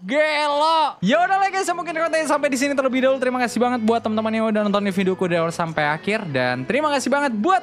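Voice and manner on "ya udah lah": ordinary talk, nothing marked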